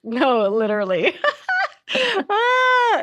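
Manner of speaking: real laughter breaking out high; laughing. Real, not acted. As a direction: laugh helplessly in agreement. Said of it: "I'm laughing because".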